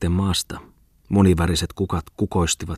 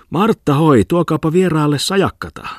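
called out loud and high across the house; calling out; 9 semitones higher, 5 dB louder than usual. A narrator's reading. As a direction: shout for the maid from the doorway. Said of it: "Niin huusi Muttinen salin ovelta".

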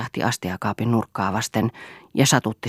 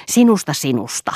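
louder, gruff mocking echo; rough-voiced retort; 3 semitones higher, 6 dB louder than usual. A narrator's reading. Eero mocking Lea's opinion, in a rough voice. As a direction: throw the word back roughly. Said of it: "sanoi Eero karkealla äänellä".

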